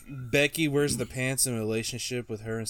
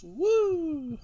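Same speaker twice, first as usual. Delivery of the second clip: Ric Flair woo. Retold or imitated. Ric Flair woo on the podcast, imitating his trademark yell. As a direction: let out the signature woo. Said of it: "The Woo suits".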